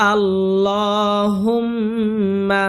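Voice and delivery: long nasal hum on the মিম of আল্লাহুম্মা; held ghunnah. Shown as a demonstration. Teacher showing the mim rule, আল্লাহুম্মা with held ghunnah. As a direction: chant আল্লাহুম্মা slowly, holding the doubled m. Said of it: "ওয়াজিব গুণনা করতে হবে".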